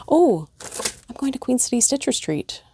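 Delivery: ordinary delivery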